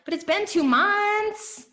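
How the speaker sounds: high, slow whine of an impatient listener; mock whiny complaint. Mimicking a frustrated listener, high whiny protest. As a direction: pitch it way up and drag it like a complaint. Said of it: "You're like".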